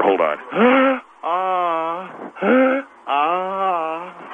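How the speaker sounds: hammed-up gasps for air; mock gasping for air. Coach pretending he needs air after the grilling, gasping. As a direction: gulp air like he's overwhelmed. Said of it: "I gotta get some air".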